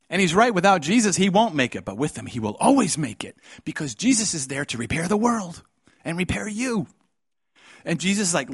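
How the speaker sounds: high, loud impassioned preaching; full-force preaching. Acted. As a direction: pour it out with fervor.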